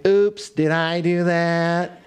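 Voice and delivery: slow, nasal-free nerdy sitcom voice. Retold or imitated. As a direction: play the awkward nerd, drawn out. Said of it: "this nerdy voice".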